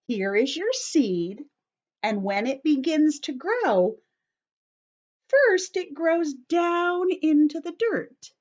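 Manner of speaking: ordinary delivery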